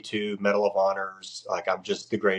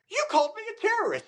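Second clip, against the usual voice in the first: indignant disbelief. Retold or imitated. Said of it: "And I was like".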